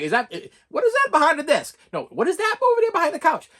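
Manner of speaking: a dad hamming up a hint about a hidden present; hammed-up Christmas Story dad. Dad teasing daughter toward the hidden doll, hammed-up high voice. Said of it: "I had a Christmas Story moment".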